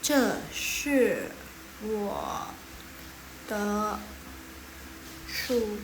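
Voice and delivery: "Ce shi wo de shu" each syllable set apart with pauses; separated syllables. Shown as a demonstration. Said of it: "ini semuanya dipisah". ("Ce shi wo de shu" dipisah per kata)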